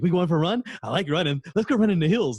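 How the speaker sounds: hint of a dog pleading for a run; eager dog voice. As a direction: beg to go running like a happy dog. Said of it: "He's like".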